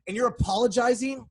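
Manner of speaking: loud, high-pitched incredulous outrage; indignant rant. Real, not acted. Critic protesting Picard apologizing after a murder, indignant. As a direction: argue back loudly in disbelief.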